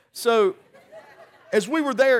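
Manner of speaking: talking through a laugh. Real, not acted. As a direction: laugh while getting back to the story. Laughing at the story.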